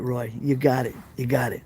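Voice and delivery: normal talking voice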